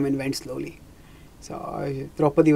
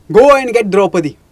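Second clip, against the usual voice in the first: loud commanding shout; loud order; 5 semitones higher, 13 dB louder than usual. Acted. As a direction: snap the order at the servant. Duryodhana orders the servant.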